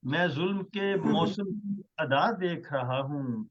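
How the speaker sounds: measured, stately poetry recital; ghazal recitation. Acted. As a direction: recite each line with weight. Poet recites his ghazal.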